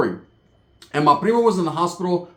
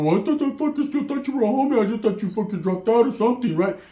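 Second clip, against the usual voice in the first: higher, whiny imitation of the cousin; mock cousin; 7 semitones higher than usual. Cousin excusing himself to narrator, high whiny mock. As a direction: pitch it up like a clueless kid. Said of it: "You go".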